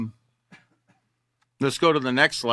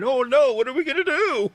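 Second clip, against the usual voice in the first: high, hammed-up fake horror, much higher pitch; mock panic; 10 semitones higher than usual. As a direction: throw up your hands in pretend dismay over nothing. Sarcastic fake panic at births.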